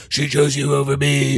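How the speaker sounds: distorted monster voice on a steady monotone; put-on villain voice, held on one note; Gabriel. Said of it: "Except in the Gabriel voice".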